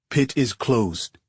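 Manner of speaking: put-on slow, terse Middle Eastern accent; guard with a Middle Eastern accent. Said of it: "in a Middle Eastern accent".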